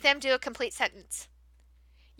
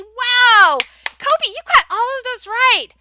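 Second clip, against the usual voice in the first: higher, louder, gushing praise; excited praise; 4 semitones higher, 6 dB louder, slower than usual. Shown as a demonstration. Teacher praising a child who got all right, excited. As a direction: cheer the child on warmly. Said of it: "You'll say".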